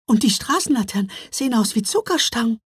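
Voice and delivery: high, breath-caught wonder; astonished little girl, pitched high; amazed gasping. A narrator's reading. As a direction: gasp it out as if you can't believe your eyes. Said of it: "japste Mila".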